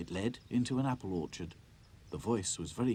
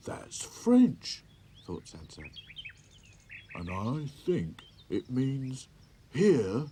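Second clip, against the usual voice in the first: higher, slow, working it out; puzzled toy voice; 5 semitones higher, 5 dB louder, much slower than usual. A narrator's reading. Sad Sack working out the French doll's words, slow and higher. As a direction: think it through aloud, slowly.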